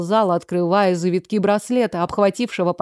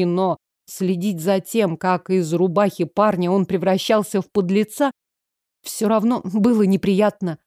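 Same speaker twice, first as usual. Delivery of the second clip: usual speaking voice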